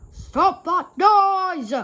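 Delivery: loud, high, slow bellow. A narrator's reading. Fat Controller bellowing at weeping engines to stop. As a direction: roar it across the yard. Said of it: "bellowed the Fat Controller".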